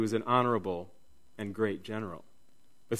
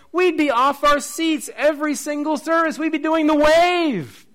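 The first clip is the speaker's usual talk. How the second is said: high, building preacher's exhortation; rousing preaching; 13 semitones higher, 6 dB louder than usual. Acted. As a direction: drive it up to an exultant shout.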